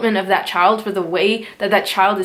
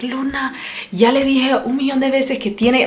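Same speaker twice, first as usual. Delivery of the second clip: higher, scolding Spanish outburst, half-replayed; retold yelling at daughter; 5 semitones higher than usual. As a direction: snap at the child in a raised scolding voice. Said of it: "and I yelled".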